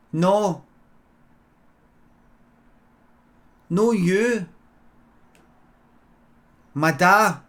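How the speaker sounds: a hint of a child explaining in short bursts; small boy. Wee boy answers the man at his window, lightly childlike.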